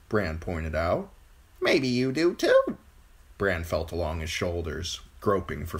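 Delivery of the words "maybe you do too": high bird voice; reply as a knowing bird; pert crow. A narrator's reading. Crow hinting at wings to Bran, pert high voice.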